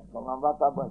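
ordinary talk

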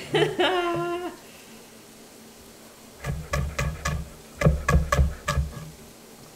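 long high breathless laugh; big laugh. Real, not acted. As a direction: crack up helplessly. Cracking up at chat.

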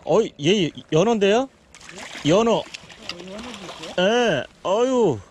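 loud, high astonished cry; real surprise, voice jumping up and loud; surprised exclaim. Real, not acted. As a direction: shout out in real amazement at a salmon. Neighbour unexpectedly lands a salmon.

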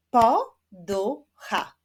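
"Poducha" in three slow, loud, separate beats; split into syllables. Shown as a demonstration. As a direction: clap out "Poducha" slowly, syllable by syllable. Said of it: "wyklaszczmy sylaby w wyrazie".

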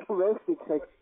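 normal talking voice

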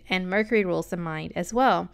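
ordinary talk

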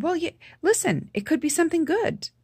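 bright, high, selling hope to her brother; forced convincing cheer. Retold or imitated. Reassuring her sick brother it could be good, forced cheer. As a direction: put on a hopeful face and voice. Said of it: "Convincing face".

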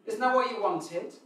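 put-on high, loud, aggrieved kid voice; indignant children. Children defending themselves to complaining parents, loud and indignant. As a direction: protest like a child who did what was asked. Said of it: "the children over here and go".

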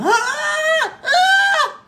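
forced, shouted high "あー" with pushed throat; strained falsetto. Shown as a demonstration. Coach demonstrating the wrong strained falsetto to students, shouted and tense. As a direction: belt the head voice with tension, the wrong way. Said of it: "力が入るような".

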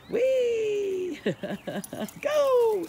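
high loud squeal of joy; delighted whee. Real, not acted. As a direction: squeal with glee. Dogs zooming past.

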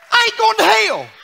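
shouted, high preaching cry; preaching at full force. Acted. Pastor preaching happy to the congregation, shouting. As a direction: lift the church with a full-voiced shout.

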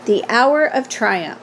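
ordinary delivery, nothing marked

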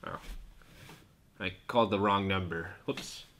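put-on very low, soft old radio host; deep low voice. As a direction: murmur it like a tired old host. Hosts talk low and quiet.

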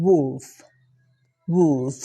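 "wolf" said slowly, ending in an F; final F sound. Shown as a demonstration. Teacher models wolf for learners, careful pronunciation. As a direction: say "wolf" on its own with an F at the end. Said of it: "มีเสียง F ท้ายเสียงครับ".

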